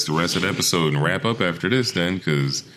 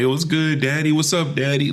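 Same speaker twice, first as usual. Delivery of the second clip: 1 mock New Yorker; 8 semitones higher than usual.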